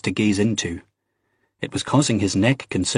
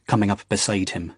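ordinary delivery, nothing marked

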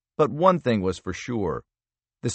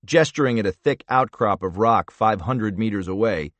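plain reading voice

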